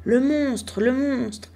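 high panicked cry, a touch breathless; screaming in panic. A narrator's reading. Breathless court lady bursting in, crying out in alarm. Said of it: "en hurlant".

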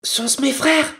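very high, rising question; hopeful wife. Acted. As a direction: ask eagerly, calling upward.

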